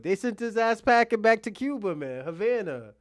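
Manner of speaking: normal talking voice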